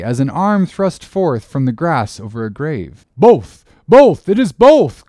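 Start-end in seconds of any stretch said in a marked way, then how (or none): 3.2-5.0 high, loud, jubilant cry; elated sailor hailing the rescue; joyful shout. Acted. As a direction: shout the good news in relief. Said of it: "cried Dago again with a joyful shout".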